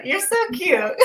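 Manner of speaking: high squeal through laughter; laughing. Real, not acted. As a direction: gush and laugh at once. Host laughs at guest's look.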